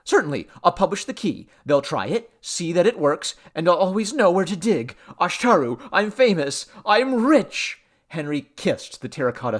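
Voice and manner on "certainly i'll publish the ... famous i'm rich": high, loud, mounting glee; exultant excitement. A narrator's reading. Man telling the god of coming fame, exultant. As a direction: build to a triumphant peak.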